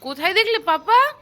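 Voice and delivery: put-on high, loud sing-song coo to a toddler; baby talk. Parent cooing at her small child, high baby talk. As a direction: coax the little one with bright baby talk.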